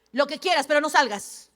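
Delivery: usual speaking voice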